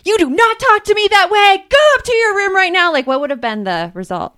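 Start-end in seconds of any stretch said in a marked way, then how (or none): 0.0-2.9 higher, louder scolding parent; hypothetical mom blowing up at tween back talk; angry parent yell. Pretend. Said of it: "You're like".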